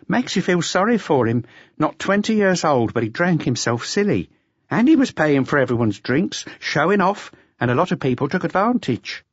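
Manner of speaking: waitress voice. Acted. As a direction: explain with a touch of pity. Waitress explains the noise.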